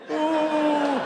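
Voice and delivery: loud, high, drawn-out boo; booing heckler. Retold or imitated. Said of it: "Your brother's going".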